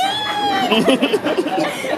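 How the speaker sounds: high burst of laughter; loud laugh. Acted. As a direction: let the giggle explode. Breaks up laughing mid-line.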